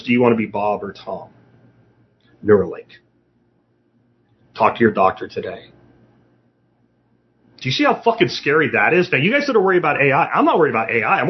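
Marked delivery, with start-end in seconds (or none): 0.0-5.7 low, smooth ad announcer tag line; commercial voiceover. Acted. Closing an imagined Neuralink commercial for viewers, low announcer voice. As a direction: sell it like the end of a TV ad. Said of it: "Here's the commercial for it".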